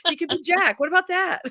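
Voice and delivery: high, playful what-if voice; mock inner voice. Mock pondering a nickname to her friends. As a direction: toss the idea off brightly up high. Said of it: "laughs, retold thought".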